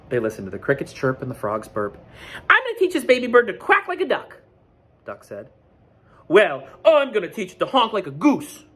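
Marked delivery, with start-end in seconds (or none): 2.5-4.4 high and loud, boasting; proud Duck. Acted. 6.3-8.6 higher and louder, answering back; honking Goose. Acted. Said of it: "Goose honked back".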